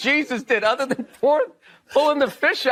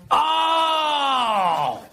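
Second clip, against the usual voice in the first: sudden startled cry of realization; surprised oh; 3 semitones higher than usual. Retold or imitated. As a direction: blurt out a shocked oh. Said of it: "And I was just like".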